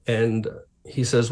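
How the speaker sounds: normal talking voice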